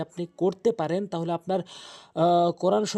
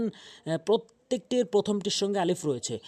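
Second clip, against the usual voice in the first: usual speaking voice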